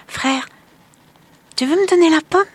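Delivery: put-on very high, gentle child's question; Marlène asking her brother for the apple, high and timid; timid little girl. A narrator's reading. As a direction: ask shyly, hoping for an answer.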